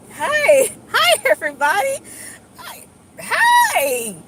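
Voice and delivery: very high, loud mock-chirpy hello; goofy over-cheery greeting. Mocking people who greet everyone smiling, goofy squealed cheer. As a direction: squeal hellos like a fake smiler. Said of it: "I don't go around".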